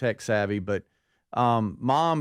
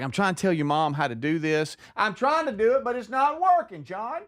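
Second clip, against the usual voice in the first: much higher, pleading mom voice; frustrated mom; 7 semitones higher than usual. Retold or imitated. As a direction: whine it at John, fed up. Frustrated mom complaining to dad.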